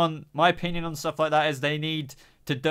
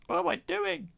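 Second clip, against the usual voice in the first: very high squeaky self-mocking voice; comic squeak. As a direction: squeal it in falsetto, mocking yourself. Laughing at own muddled farewell.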